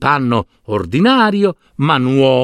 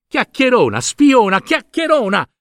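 much higher, repeated furious yelling; angry shouting; 11 semitones higher than usual. Acted. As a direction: hurl the insults, angrier each time. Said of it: "ripeté Alberto, arrabbiandosi sempre di più". (sempre più arrabbiato, gridando)

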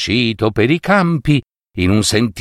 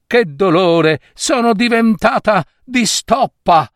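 crying out in pain; 10 semitones higher than usual. A narrator's reading. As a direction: howl as if the flesh is torn. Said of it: "si sentirono le urla della Reginotta".

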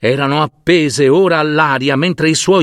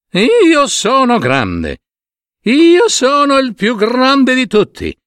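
swagger like the biggest beast alive; boastful elephant; 10 semitones higher than usual. Acted. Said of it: "Barriva a squarciagola l'elefante". (elefante vanitoso che si vanta, voce tronfia)

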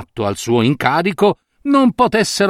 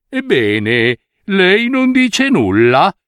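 weaver exclaiming; 4 semitones higher, slower than usual. A narrator's reading. Weaver demanding the minister's opinion, slow and pointed. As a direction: press him with sly insistence. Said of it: "esclamò uno dei tessitori".

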